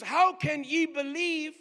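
HOW CAN YE BELIEVE stretched slowly as a declaration; challenge as statement. Retold or imitated. Preacher requotes Jesus from Saint John, challenge as statement. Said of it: "he's saying it as a statement".

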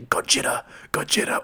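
whispered, dazed repetition; mesmerized murmur. Retold or imitated. Spellbound sailor repeats the name, in a whisper. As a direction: breathe it out in a trance. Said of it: "he's just like".

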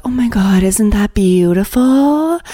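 imitated group leader in mock rapture; mock gushing. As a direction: gush over it, stretching the last word. Imitating the gushing lady in charge.

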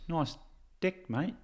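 put-on New Zealand accent. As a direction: say nice deck with a Kiwi twang. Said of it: "I was practicing my New Zealand accent".

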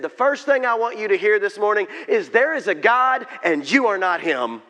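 loud, high opening shout from the stage; imitating a booming youth speaker; forceful proclamation. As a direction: belt it out like a session opener. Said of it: "It was like out the door this came".

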